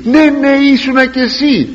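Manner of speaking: high, loud mock accusation; accusing servant girl. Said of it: "Μια υπηρέτρια, μια δούλη".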